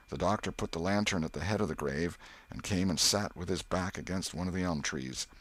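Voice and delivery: usual speaking voice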